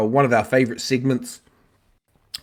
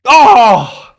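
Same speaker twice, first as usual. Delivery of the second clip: surprised; 9 semitones higher, 11 dB louder than usual. Real, not acted. Reacting to wrong answer.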